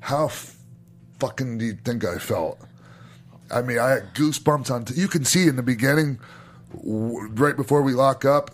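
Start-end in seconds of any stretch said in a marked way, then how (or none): none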